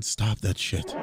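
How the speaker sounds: normal talking voice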